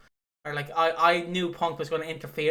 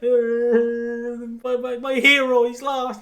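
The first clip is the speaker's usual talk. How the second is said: higher, louder mock wail of dismay; lightly voiced heartbroken fan; friend's dismay; 8 semitones higher, 9 dB louder, much slower than usual. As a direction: friend mourning his beaten hero, hammed-up. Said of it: "Ash is like".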